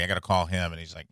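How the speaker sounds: ordinary talk, nothing marked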